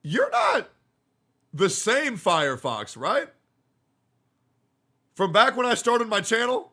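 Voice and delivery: high-pitched incredulous questioning; stunned, high-voiced double-check; amazed disbelief. Real, not acted. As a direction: ask in rising, hopeful disbelief. Asks if this is old friend.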